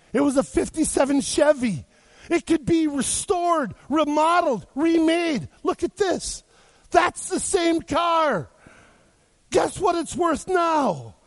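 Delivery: high, slow, ringing climax; triumphant preaching. Acted. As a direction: build to a proud shout.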